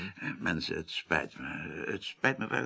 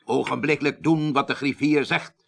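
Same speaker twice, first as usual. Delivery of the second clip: loud sharp order; 7 semitones higher, 8 dB louder than usual. Acted. Superior barks order at secretary.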